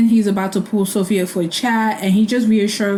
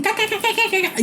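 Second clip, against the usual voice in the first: high, quiet mock cackle; bird-like mock cackle sounds; imitated cackling; 12 semitones higher, 4 dB quieter than usual. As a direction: peck out little cackles up high. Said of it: "they were just cackling".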